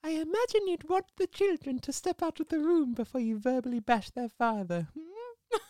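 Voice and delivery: high, prim granny voice; prim old nanny. Retold or imitated. Doubtfire rebuking Miranda in front of kids, prim old lady. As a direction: chide her politely as an old nanny. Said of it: "Missus Doubtfire goes".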